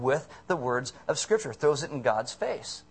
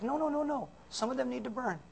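high, softer mock protest; put-on high voice, objecting; mock objection; 9 semitones higher, 6 dB quieter than usual. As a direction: imitate a squeamish, high-voiced refusal. Voices church refusing others' repentance.

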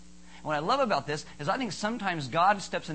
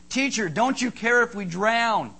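loud, raised cry of reproach; alarmed disciples; 5 semitones higher, 5 dB louder than usual. Acted. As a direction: plead urgently, as if about to drown. Acting out disciples alarmed in storm.

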